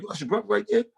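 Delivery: high-pitched, incredulous imitation of onlookers; mock disbelief. Onlookers doubting he's your brother, high imitated disbelief. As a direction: question it in high mock surprise.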